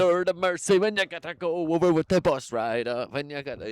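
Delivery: mock Jamaican accent, lilting. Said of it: "Jamaican accents".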